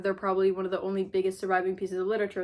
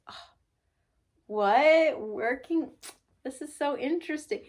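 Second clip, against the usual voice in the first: mock fascinated rich girl; 6 semitones higher, 3 dB louder than usual. Mocking rich girl meeting worker.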